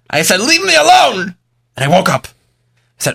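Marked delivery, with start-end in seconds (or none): 0.4-1.4 high, loud "leave me alone"; screamed. Retold or imitated. Man screaming at the Baal Shem Tov in his dream, loud and high. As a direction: yell it at the dream figure. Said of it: "I screamed at him".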